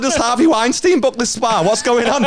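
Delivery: high, loud comic outrage; alarmed protest. Retold or imitated. Nervous spa customer challenging receptionist, mock-alarmed. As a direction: retell it as a laughing, incredulous outburst.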